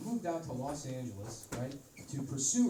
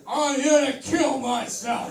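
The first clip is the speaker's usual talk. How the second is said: big high announcing shout; triumphant shouted announcement; 11 semitones higher, 13 dB louder, slower than usual. Acted. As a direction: proclaim it to the whole room. Gesturing to crowd, announcing.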